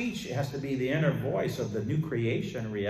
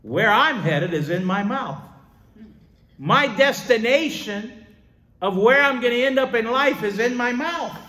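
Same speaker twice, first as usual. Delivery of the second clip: loud, high preaching proclamation; fervent declaration; 11 semitones higher, 8 dB louder than usual. Acted. Preacher decreeing their own destination, fervent declaration. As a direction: proclaim it high and forceful, building in pitch.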